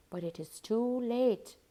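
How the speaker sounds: ordinary delivery, nothing marked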